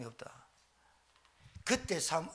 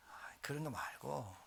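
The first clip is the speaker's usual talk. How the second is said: whisper; 5 semitones lower, 16 dB quieter than usual. Acted. Aside to congregation.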